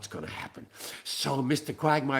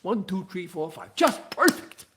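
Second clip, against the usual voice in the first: usual speaking voice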